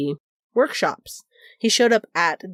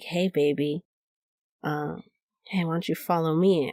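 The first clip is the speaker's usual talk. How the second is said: sultry vampire; 2 semitones lower, 6 dB quieter than usual. Retold or imitated. Cool vampire lures her away.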